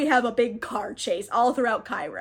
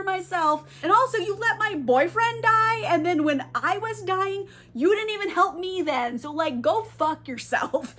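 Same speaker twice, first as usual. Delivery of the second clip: higher-pitched indignant rant; very mad; 6 semitones higher than usual. Retold or imitated. Cleopatra blaming Ramses on the dance floor, indignant. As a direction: imitate an aggrieved, building accusation. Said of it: "She's very mad at him".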